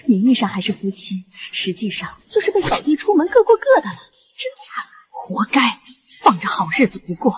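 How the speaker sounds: gloating. Acted. Gossiping servant mocks husband.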